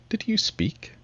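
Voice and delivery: high, polite questioning lift. A narrator's reading. Frowning mouse questioning the Lory, very polite. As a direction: ask politely, pitched well up. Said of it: "but very politely".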